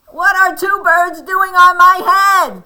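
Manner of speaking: high, very loud storybook Elephant; alarmed outcry. Acted. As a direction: cry it out in disbelief. Elephant's outraged question in story.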